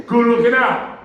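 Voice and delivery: high, loud, emphatic call; hollered thanks. Retold or imitated. Huge neighbor thanking him about the car, hollering. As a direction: imitate the big neighbor shouting his thanks.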